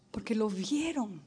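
hushed whisper after the cry. Real, not acted. Preacher to congregation after the elders' cry, whispered. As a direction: drop to a whisper to let it land.

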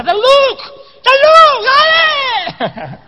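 very high, strained yell to a teammate; shouted urging. Retold or imitated. Runner yelling at his slow teammate to hurry, shouted urging. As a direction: scream the name out high and drawn-out, then drop off.

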